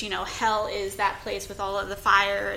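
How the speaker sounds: ordinary talk, nothing marked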